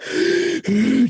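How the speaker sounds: heavy gasp to calm down; imitated shaken breathing; panicked gasping. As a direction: suck in air after a scare. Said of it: "calm myself down".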